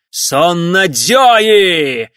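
very loud, long-drawn furious yell; enraged battle cry. Acted. Enraged samurai shouts after the escaping riders, drawn-out war cry. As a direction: bellow it after them, stretching out the sound. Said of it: "закричал им вслед самурай, взбешённый тем".

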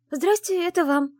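high, loud blurted greeting. Acted. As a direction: blurt it out and flee. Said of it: "выдала я".

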